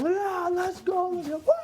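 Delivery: rally the crowd, stretched and high; hype call. Retold or imitated. Him hyping crowd on tent.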